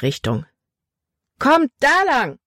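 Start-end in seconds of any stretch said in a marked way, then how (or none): 1.4-2.4 high, drawn-out urgent call; rallying shout. A narrator's reading. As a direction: call the gang on, slow and high. Caleb rallies gang into chase. (hoher, gedehnter Anfeuerungsruf)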